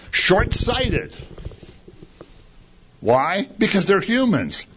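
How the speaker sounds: normal talking voice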